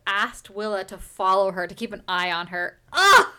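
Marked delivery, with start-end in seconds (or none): 2.9-3.3 loud, high groan of disgust; fed-up loud ugh. Real, not acted. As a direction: let out an exasperated ugh. Disgust at being followed.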